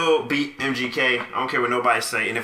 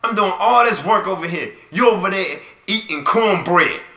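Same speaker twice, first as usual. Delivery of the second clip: mock complaint; 6 semitones higher, 5 dB louder than usual. Real, not acted. Bickering with cohost.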